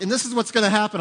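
mock flight attendant. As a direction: deliver it like a breezy safety briefing. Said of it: "flight attendant's like".

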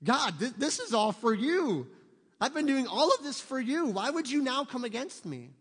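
high, loud, bewildered protest to God; stunned Saul pleading with God; shocked plea. Retold or imitated. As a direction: cry out, hurt and confused. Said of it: "I'd be a little shocked".

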